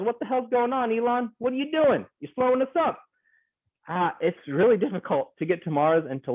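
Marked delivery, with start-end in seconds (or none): 0.0-3.0 mock scolding. Mock scolding Elon Musk.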